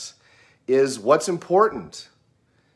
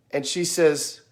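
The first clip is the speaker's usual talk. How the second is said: ordinary talk, nothing marked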